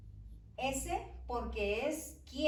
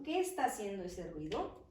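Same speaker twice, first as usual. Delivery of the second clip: ordinary delivery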